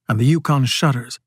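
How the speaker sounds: usual speaking voice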